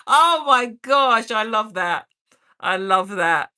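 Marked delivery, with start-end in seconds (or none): none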